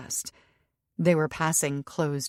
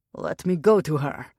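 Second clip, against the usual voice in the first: growled threat; 6 dB louder than usual. A narrator's reading. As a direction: growl it at the man blocking him. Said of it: "Maxim growled".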